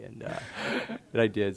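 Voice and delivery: usual speaking voice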